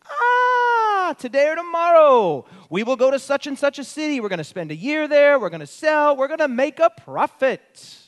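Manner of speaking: mock the self-sure planners; a lightly acted boasting merchant; mock boastful planner. Quoting boastful planners mockingly.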